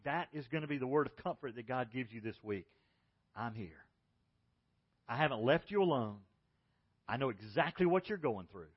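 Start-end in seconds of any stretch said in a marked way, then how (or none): 3.3-8.7 comforting whisper. Retold or imitated. God's word of comfort.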